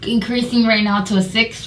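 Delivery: ordinary talk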